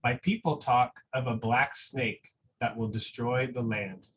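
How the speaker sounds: slow, gentle storybook reading with long pauses; reading a picture book aloud. Acted. Teacher reading a picture book to school families, slow and gentle.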